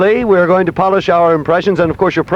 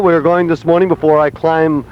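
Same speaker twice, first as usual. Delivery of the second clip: ordinary talk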